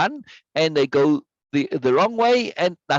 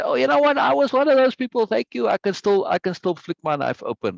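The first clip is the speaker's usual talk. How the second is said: imagined grateful customer; 8 semitones higher than usual. Pretend. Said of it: "maybe someday somebody will say".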